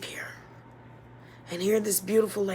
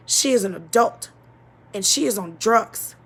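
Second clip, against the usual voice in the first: louder, rising emphasis on each reason; emphatic; 6 dB louder than usual. Real, not acted. Speaker insisting the blame was her mother's, louder and emphatic. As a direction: hammer home every point. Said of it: "insisting it was mother's fault".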